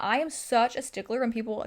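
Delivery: ordinary talk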